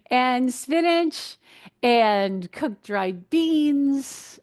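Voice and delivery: very slow, stretched-out list items; playful announcing. Real, not acted. Dietitian listing high-potassium foods, playfully stretched. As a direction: hold each food name out with relish. Continues playful food roll call.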